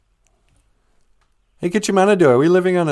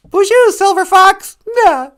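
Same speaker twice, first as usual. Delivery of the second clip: a much higher, louder put-on voice for Papa Wagosh; high puppet voice; 9 dB louder, slower than usual. Host voicing Papa Wagosh to greet a viewer, in a high loud put-on voice. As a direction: squeak out the greeting as the old puppet.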